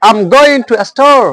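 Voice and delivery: slow example, "store" dropping at the end; falling intonation. Shown as a demonstration. Teacher giving second example, falling intonation. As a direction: let the pitch fall on "store". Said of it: "ইয়ান আর হতে fall intonation".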